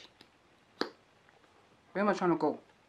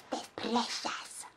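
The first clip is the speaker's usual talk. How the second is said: Gollum voice; slower than usual. Pretend. Vlogger clutches her makeup, a squeaky creepy Gollum voice. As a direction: whisper then squeaky high, slow hissing creature.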